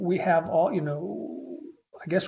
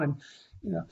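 usual speaking voice

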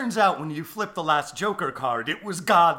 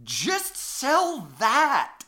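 exasperated; 7 semitones higher, much slower than usual. Acted. Mocking the villain's plan.

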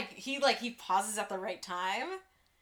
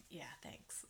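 shy whispered thanks; 9 semitones lower, 24 dB quieter than usual. Retold or imitated. Embarrassed dad answering the mom, whispered. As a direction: barely breathe it out.

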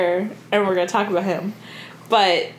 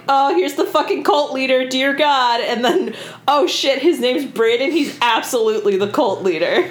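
high, exclaimed alarm from reading notes; mock alarm; 5 semitones higher than usual. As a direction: blurt it out in exaggerated dread. Acting out her alarmed reading notes.

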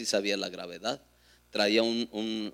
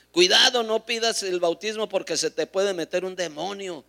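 high, loud mock-alarmed warning; raised, alarmist retold caution; imitated warning; 8 semitones higher, 6 dB louder than usual. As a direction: warn them off, sounding the alarm. Voices alarmist warners.

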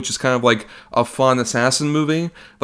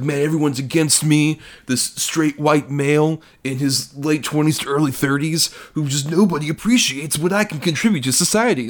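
mock whiny guy; 4 semitones higher than usual. Mocking whiny protagonist.

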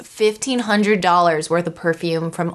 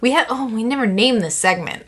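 ordinary talk

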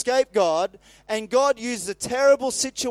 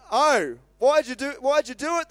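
high, raised-voice groan, read aloud; exasperated groan; 6 semitones higher, 4 dB louder than usual. A narrator's reading. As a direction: groan it out in acted dismay. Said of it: "they groaned".

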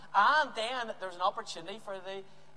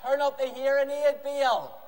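loud, high mocking call; taunting; 6 semitones higher, 7 dB louder, slower than usual. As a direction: heckle as if to someone hard of hearing. Elijah taunts the shouting prophets.

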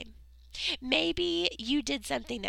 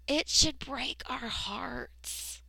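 whisper; slower than usual. Real, not acted. Earnest appeal.

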